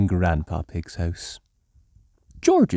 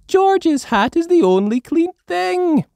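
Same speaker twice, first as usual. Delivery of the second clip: high, loud wry remark; Mummy Pig voice; 6 dB louder than usual. A narrator's reading. Mummy Pig noting only the hat is clean. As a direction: say it dryly. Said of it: "says Mummy Pig".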